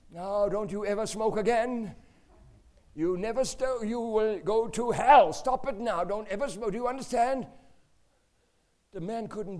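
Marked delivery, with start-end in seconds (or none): 0.1-7.5 little son lecturing a stunned smoker; child scolding. Retold or imitated. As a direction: shout the warning like a small preacher.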